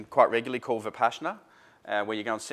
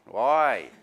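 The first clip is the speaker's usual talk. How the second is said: a lightly voiced curious kid, louder; four-year-old asking; 2 semitones higher, 7 dB louder than usual. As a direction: chirp it like a small child.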